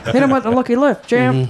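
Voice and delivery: put-on old-time fight announcer; brash 1940s boxing film voice; old-timey voice. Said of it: "Old-timey voice".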